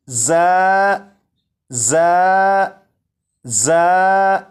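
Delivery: जा said as a clear, held model sound; model letter recitation. Shown as a demonstration. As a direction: say जा cleanly for the class to repeat. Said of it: "तीन मरतबा मैं पढ़ूंगा". (जा को साफ़ आदर्श उच्चारण में)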